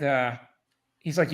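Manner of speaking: usual speaking voice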